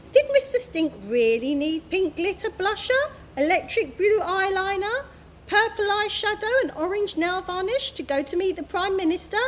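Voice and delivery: ordinary delivery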